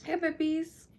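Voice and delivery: high, sing-song pet voice; squeaky hello to the puppies; talking to puppies. Real, not acted. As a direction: coo at the dogs like babies. Greeting her dogs.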